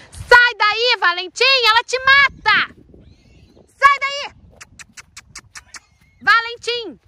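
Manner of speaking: high, loud panicked shout; real alarmed yelling. Real, not acted. Owner screaming at the colt near the mare. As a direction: scream at him to get away before she kills him. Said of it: "eu dei uns berros com ele".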